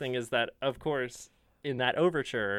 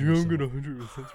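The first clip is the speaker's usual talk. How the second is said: bro voice; 5 dB louder, faster than usual. Retold or imitated. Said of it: "in that bro voice".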